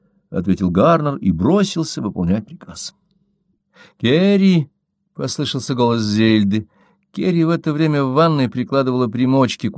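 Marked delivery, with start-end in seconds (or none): 4.0-4.7 calling out. A narrator's reading. Zelda calling Kerry from room.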